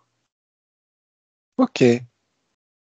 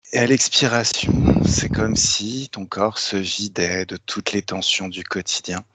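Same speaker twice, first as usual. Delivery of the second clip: guided hypnosis; 4 semitones lower than usual. Acted. Leads a hypnotic induction.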